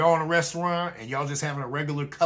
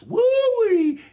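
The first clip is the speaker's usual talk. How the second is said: a much higher, louder whooping "Woo-wee"; excited whoop; 10 dB louder than usual. Real, not acted. Host whooping to viewers about the strong beer, high and loud. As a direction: let out a big whoop at how strong it is.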